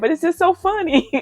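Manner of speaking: high-pitched, tickled protest breaking into a laugh; retold cracking up. Lady apologising for laughing at the mother, high tickled voice. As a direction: imitate a helplessly amused lady, very high. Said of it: "She was cracking up".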